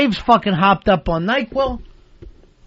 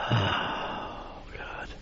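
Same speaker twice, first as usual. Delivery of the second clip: whispered, breathy sigh of despair; barely audible, weary sigh; exasperated sigh; 11 semitones lower, 18 dB quieter than usual. Real, not acted. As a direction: sigh it out under your breath, worn out. Exasperated by co-host's ghost talk.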